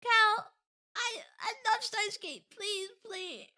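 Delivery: small, pitiful pleading voice; pleading fan. Retold or imitated. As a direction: imitate a fan whimpering a plea. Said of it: "All the Steins Gate fans that were".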